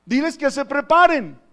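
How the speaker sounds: loud, high proclamation of a divine command; Jesus commanding. Retold or imitated. Preacher voices the Lord's message to his church, loud commanding proclamation. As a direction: proclaim it forcefully to the whole church. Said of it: "el Señor Jesús le dice".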